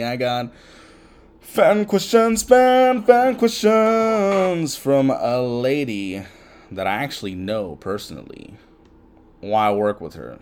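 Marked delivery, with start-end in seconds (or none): 1.4-6.2 mock announcer. Announcing the next fan question.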